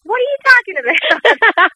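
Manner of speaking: high, loud mock outrage; indignant disbelief. Daughter retelling her shocked reply to her dad, loud and indignant. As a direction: yelp it like you can't believe your ears. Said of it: "I would go".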